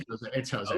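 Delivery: "Jose" with an H sound, "ho-ZAY"; Spanish J pronunciation. Real, not acted. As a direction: answer with "Jose" starting like hoe. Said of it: "is it Jose or Jose".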